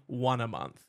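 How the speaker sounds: normal talking voice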